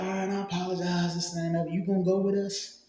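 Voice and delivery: high, dismissive mimicked apology; imitating apologetic girlfriend. Retold girlfriend apologizing, pitched up.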